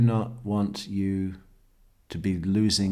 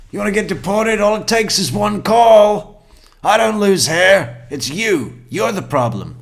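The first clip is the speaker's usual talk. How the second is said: much higher and louder mock rant; mocking nagging husband; 11 semitones higher, 10 dB louder than usual. Husband bitching at his wife over shower hair, high loud mock rant. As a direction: ham up a bullying husband yelling over the shower. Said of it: "he's been bitching at her, saying".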